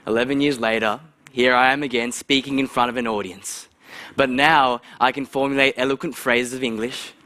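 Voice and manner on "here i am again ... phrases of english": ordinary talk, nothing marked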